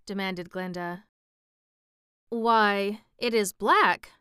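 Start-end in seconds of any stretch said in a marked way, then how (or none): 2.3-4.1 puzzled surprise at what is seen; a touch of astonishment; wondering. A narrator's reading. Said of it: "in a tone of wonder".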